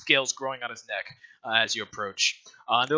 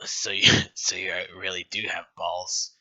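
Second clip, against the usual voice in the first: laughing whisper; 6 semitones lower than usual. Acted. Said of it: "the bartender is like".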